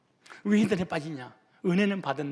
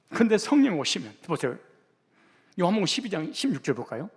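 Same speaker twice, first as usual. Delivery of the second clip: normal talking voice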